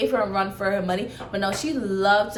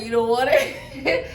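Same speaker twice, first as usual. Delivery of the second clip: laughing. Retold or imitated. Teasing her over the dime.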